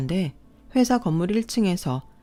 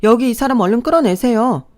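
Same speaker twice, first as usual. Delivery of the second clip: higher and louder, order the guards out; loud command; 5 semitones higher, 7 dB louder than usual. Retold or imitated. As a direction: bark at the guards to hurry. Ordering guards to drag him out. (경비원에게 단호하게 외치는 명령)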